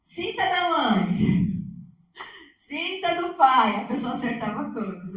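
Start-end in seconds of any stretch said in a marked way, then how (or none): 0.0-3.8 called-out guess. Retold or imitated. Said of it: "Aí falava".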